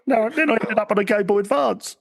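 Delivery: high-pitched woeful mascot voice; hammed-up self-pity; mock lament. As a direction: complain in a squeaky, put-on voice. Joins in as a discarded mascot.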